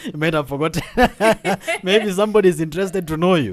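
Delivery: high, loud, laughing through the words; bubbling over with laughter, fast at the end; laughing while teasing. Real, not acted. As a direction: tease her through a big laugh. Host teasing guest about her name.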